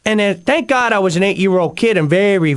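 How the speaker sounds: ordinary talk, nothing marked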